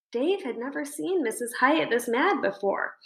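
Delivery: plain reading voice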